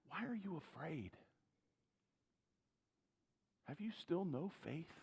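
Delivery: soft, gentle question; genuine calm. Acted. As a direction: ask kindly, with no reproach. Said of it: "Jesus embodies a genuine calm when he asks next".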